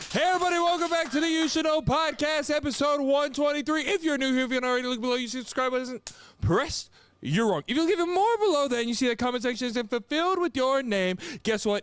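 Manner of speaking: big over-the-top show opener; hype announcer. Acted. Podcast intro announcer.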